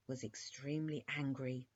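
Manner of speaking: usual speaking voice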